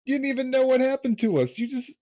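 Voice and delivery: high, loud indignant rant; furious, demand an answer; angry outburst. Acted. Angry quarrel with NPC.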